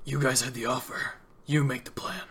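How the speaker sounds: throat-straining gruff villain; gruff Two-Face. Acted. Crime boss hands planning back to visitors, gruff. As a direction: put the rough crime boss voice on. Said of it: "I'm ruining my voice doing this".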